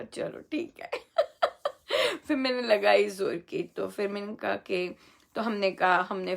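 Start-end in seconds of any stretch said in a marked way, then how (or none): 0.0-0.9 soft breathy whisper; whispered aside. Retold or imitated. Answering the kid who tore a kite, whispered okay. Her reply to the child retold. 1.0-2.2 high ringing laugh; amused laugh. Real, not acted. Recounting the kite fight to viewers, amused laugh.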